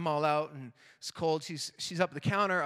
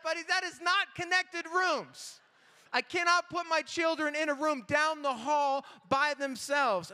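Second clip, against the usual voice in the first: higher, incredulous protest to the clerk; mock outraged father; exasperated dad; 7 semitones higher than usual. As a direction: push back in disbelief.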